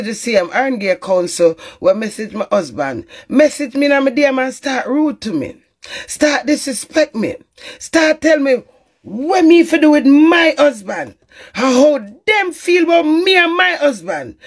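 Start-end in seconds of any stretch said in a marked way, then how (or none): 6.1-14.4 heated rant. Real, not acted. Ranting at the council worker.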